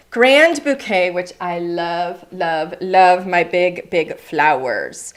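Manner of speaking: slow, low and drawn out with delight; gushing. Real, not acted. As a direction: linger lovingly over each word. Gushing over favourite stamp set.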